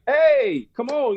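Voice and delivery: high, bright friendly shout of greeting; excited greeting. Retold or imitated. Teammates greeting Greg in a high excited voice. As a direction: players hailing an old friend. Players welcoming him in.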